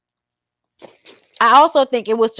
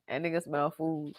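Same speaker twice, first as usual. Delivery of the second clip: ordinary talk, nothing marked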